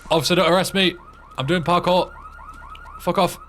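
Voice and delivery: hammed-up cry to an officer; pleading parkour runner. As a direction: shout it up high at a policeman. Acting someone fleeing police in game.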